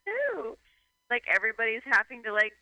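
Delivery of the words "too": very high, entitled whine; imitated pampered wife. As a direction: whine it like a spoiled rich wife. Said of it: "entitled wife again".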